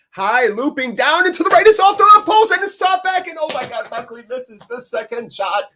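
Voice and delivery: high-pitched frantic commentary; real goalmouth excitement, voice shooting up; excited play-by-play. Real, not acted. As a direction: build to a shouted peak as the chance unfolds. Live call of a scoring chance.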